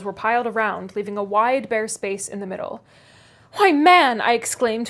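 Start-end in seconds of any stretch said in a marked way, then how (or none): 3.5-4.2 much higher and louder indignant cry; indignant gentleman, loud; angry exclamation. Acted. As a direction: burst out in outrage. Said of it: "facing him angrily".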